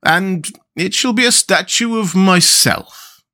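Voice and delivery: vain mayor. A narrator's reading. Mayor claiming the new statue, slow and vain. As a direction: announce it grandly to yourself. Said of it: "he said".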